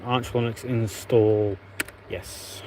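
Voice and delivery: ordinary talk, nothing marked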